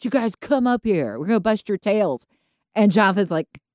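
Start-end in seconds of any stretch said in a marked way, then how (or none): 0.0-2.3 calling down. Acted. Philistines on the hill to Jonathan and his servant, calling down tauntingly. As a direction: call down a cocky challenge from on high. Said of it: "look down at them from the hill and say".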